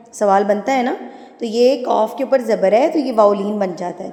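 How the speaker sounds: ordinary talk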